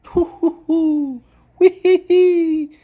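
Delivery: very high falsetto hoots, then a slow squealing "wee hee hee"; gleeful hooting cackle. Real, not acted. Reacting to the definition in a very high gleeful hoot. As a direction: whoop like you just caught them out.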